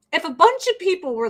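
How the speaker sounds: usual speaking voice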